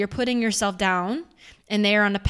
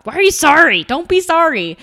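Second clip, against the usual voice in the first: louder, higher dad, puzzled and firm; dad's voice; 8 semitones higher, 9 dB louder than usual. Retold or imitated. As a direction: play the dad telling her not to apologize. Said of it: "That's totally my dad".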